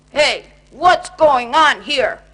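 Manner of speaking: loud, slow grumpy bear; grumbling. Acted. Grumpy Bear woken at night, loud slow grumble. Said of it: "grumbled Grumpy Bear in his usual grumpy way".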